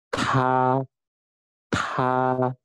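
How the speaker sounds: "Ka" as airy-T "tā", long and level; first tone syllable. Shown as a demonstration. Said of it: "di sini ada garisnya lurus". ("Ka" dibaca tā dengan nada lurus)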